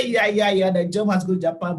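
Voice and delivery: dismissive retort. Retold or imitated. Brushes off boss in retold quarrel.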